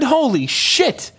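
ordinary talk, nothing marked

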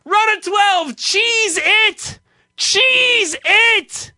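high, loud yelled order across the kitchen; screamed kitchen order. Retold or imitated. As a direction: bark the order out over the grill noise. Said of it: "They would scream that".

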